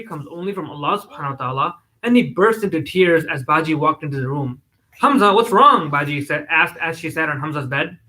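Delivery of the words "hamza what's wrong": higher, louder worried question; concerned. A narrator's reading. Sister walks in on her crying brother, concerned. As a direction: ask with sudden concern.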